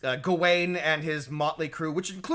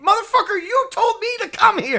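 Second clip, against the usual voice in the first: high loud exasperated outburst; indignant outburst; 8 dB louder than usual. Retold or imitated. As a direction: blurt it out in disbelief. Son's indignant retort.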